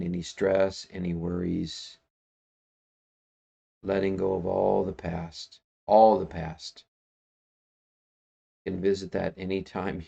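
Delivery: calm, unhurried voice with long pauses; guided meditation. Acted. Meditation leader inviting listeners to let go of worries, calm and unhurried.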